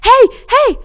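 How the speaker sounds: high loud shout across the street; Kenny hailing his cousin's car, loud call. Retold or imitated. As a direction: wave and holler. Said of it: "starts aggressively waving".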